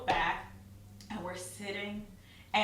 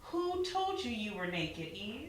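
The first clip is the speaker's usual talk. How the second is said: higher, slow rhetorical challenge; a hint of God questioning; 4 semitones higher, 4 dB quieter, slower than usual. God challenging her beliefs, slow rhetorical questioning. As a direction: question her gently from above.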